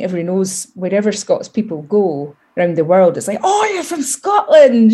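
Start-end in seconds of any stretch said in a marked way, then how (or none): 3.4-4.9 excited greeting. Retold or imitated. Excited locals greeting Scots abroad.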